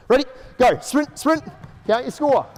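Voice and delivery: coach calling out. Real, not acted. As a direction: push the players as they run. Coach calling cues during drill.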